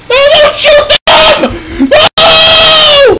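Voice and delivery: very high, loud drawn-out shriek; long, shrill cry of alarm; panicked scream. Real, not acted. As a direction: scream it out in desperation. Panic in game.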